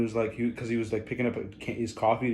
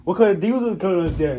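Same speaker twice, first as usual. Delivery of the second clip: imitating a confident stranger, higher and louder; confident, hard to understand; 5 semitones higher, 6 dB louder, faster than usual. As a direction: run the words together with total confidence. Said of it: "This dude was so confident about it".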